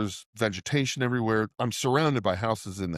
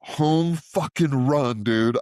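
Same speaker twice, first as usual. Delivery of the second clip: triumphant; 4 semitones higher, 4 dB louder, much slower than usual. Retold or imitated. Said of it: "I'm like".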